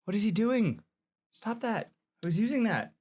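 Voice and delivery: high-pitched whiny protest; mock whine. Real, not acted. Complaining as friend touches camera.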